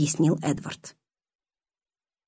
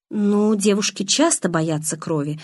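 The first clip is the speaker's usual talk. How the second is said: usual speaking voice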